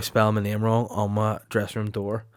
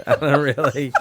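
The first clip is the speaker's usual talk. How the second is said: laughing through the words; 4 semitones higher than usual. Real, not acted. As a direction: giggle out the excuse. Laughs through his answer.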